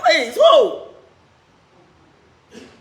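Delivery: ordinary talk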